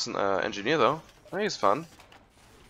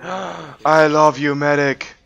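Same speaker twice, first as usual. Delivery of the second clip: excited shout; 4 semitones higher, 10 dB louder, much slower than usual. Real, not acted. Gets healed mid-fight.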